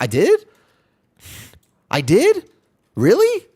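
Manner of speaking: rising squeals of genuine surprise; surprised. Real, not acted. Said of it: "reacting to chat".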